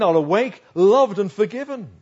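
loud, lifting declaration; fervent hope. Acted. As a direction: proclaim it boldly, as a dream of being forgiven. Preacher voices Job's hopeful dream.

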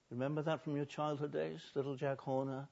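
usual speaking voice

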